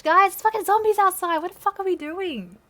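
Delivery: loud, high-pitched scolding; exasperated shout. Real, not acted. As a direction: yell at them to get serious. Yells at characters ignoring the zombies.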